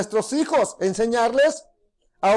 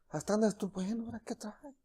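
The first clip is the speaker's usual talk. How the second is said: puzzled mutter; 3 semitones lower, 15 dB quieter than usual. Acted. Worker puzzled boss didn't yell.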